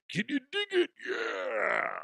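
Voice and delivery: high raspy wrestler growl, ooh yeah style; hammed-up Randy Savage promo voice; gravel-free mock wrestler catchphrase; Macho Man impression.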